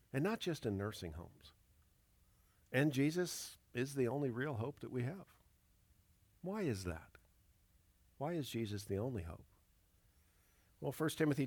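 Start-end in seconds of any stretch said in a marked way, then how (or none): none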